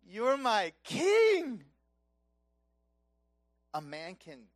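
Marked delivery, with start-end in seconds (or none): 0.0-1.6 slow and loud, leaning hard on "king"; emphatic praise. Acted. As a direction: proclaim "king" long and full. Wife praising husband coming home.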